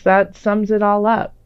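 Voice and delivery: ordinary talk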